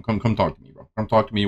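ordinary talk, nothing marked

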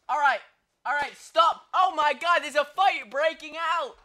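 loud, high alarmed calling; alarmed shout. Pretend. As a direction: shout as a brawl starts. Commentator alarmed by a fight.

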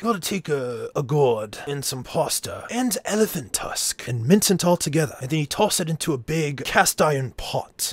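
put-on old-time cookbook voice; mock medieval recipe. Host pretending to read a medieval scroll, mock old recipe voice. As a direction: recite it as an olden recipe writer. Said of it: "That's exactly how it was written".

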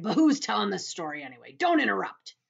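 loud, annoyed centipede; indignant cry. A narrator's reading. Centipede cross at being interrupted, loud and indignant. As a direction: scold them loudly for butting in. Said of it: "the centipede cried".

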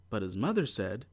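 ordinary delivery, nothing marked

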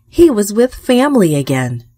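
ordinary delivery, nothing marked

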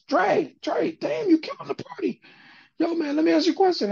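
high-pitched gushing greeting; excited fan. Retold or imitated. TV worker coming up to the DJ at a club, excited. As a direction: imitate an eager admirer, voice way up. Retold excited man approaching the DJ.

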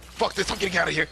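high, loud panicked yell; panicked shout. Real, not acted. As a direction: yell it while bolting away. Fleeing from enemies.

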